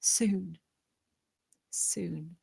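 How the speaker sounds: soft unvoiced whisper. [name] whispering a promise to Isabel, whispered. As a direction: breathe it to her gently.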